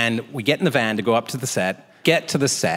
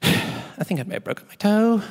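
sheepish confession; 10 semitones higher than usual. Retold or imitated. Finally blurts the confession.

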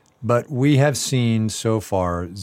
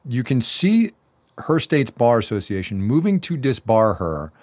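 normal talking voice

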